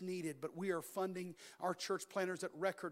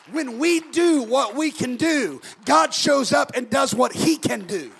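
slow booming shouted refrain; preaching at full force; 6 semitones higher, 16 dB louder, slower than usual. Acted. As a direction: drag it out and shout it.

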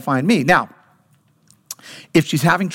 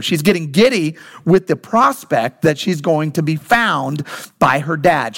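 usual speaking voice

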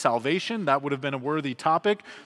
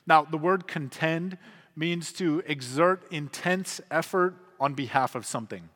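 ordinary talk

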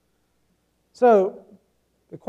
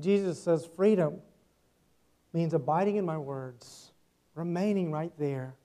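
ordinary delivery

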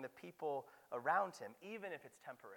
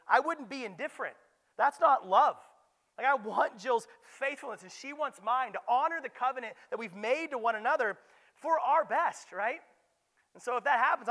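loud, high preaching build; impassioned sermon voice; full-force preaching; 8 semitones higher, 14 dB louder than usual. Acted. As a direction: drive it home from the pulpit.

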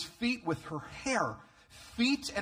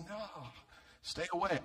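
mock recoil; 4 semitones higher, 7 dB quieter than usual. Acts out guests avoiding the woman.

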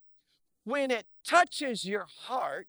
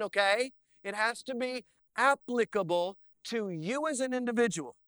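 usual speaking voice

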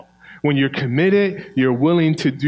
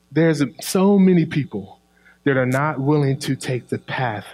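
ordinary talk, nothing marked

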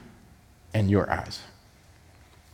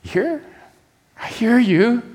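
doubtful bride; 5 semitones higher, slower than usual. Acted. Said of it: "And his reply".

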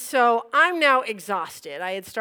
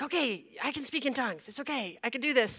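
quick, soft self-reassurance; retold panicky pep talk; nervous self-coaxing; 9 dB quieter, faster than usual. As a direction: talk yourself into it under pressure. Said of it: "I just start laughing and crying".

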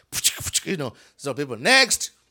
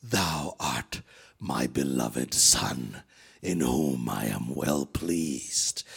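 much lower, slow, booming heavenly voice; voice of God; 5 dB quieter, much slower than usual. Retold or imitated. God speaking over his son Jesus, low and slow. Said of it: "So the voice says".

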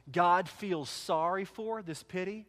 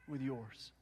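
tender; 3 semitones lower, 7 dB quieter, much slower than usual. Retold or imitated. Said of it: "But God is like".

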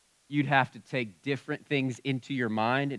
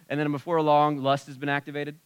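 ordinary delivery

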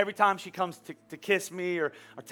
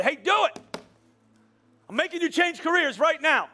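high, loud demanding order; forceful command; 9 semitones higher, 4 dB louder than usual. Retold or imitated. God as pushy commander, loud and high. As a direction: bark it like a boss forcing you. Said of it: "doesn't come and say".

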